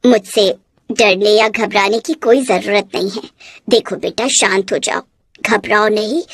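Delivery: put-on calm the frightened child as a kind witch; gentle witch. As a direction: witch reassuring a scared girl, gently.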